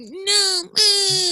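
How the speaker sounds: high whining refusal; whiny kid. Retold or imitated. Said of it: "He was like".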